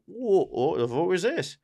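flustered mock Cockney; Tsar Nicholas. Cornered Tsar pleading with armed extremists, Cockney accent. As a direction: protest in a put-on Cockney accent. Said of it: "he's just like".

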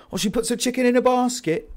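loud, high-pitched Scouse; Scouse accent. Shown as a demonstration. Demonstrating a key phrase for accents, high loud Scouse. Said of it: "the, the Scouse accent".

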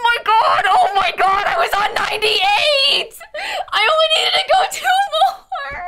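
high, loud wail of despair; drawn-out, tearful-sounding shrieks; anguished screaming. Real, not acted. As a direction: scream in disbelief at your own blunder. Just died two levels from goal.

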